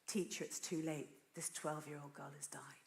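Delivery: soft, low grave report; hushed bad news. Messengers telling Jesus of a death, hushed bad news. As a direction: break the news quietly, dropping almost to a whisper.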